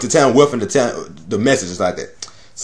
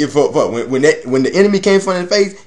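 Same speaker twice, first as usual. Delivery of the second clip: ordinary delivery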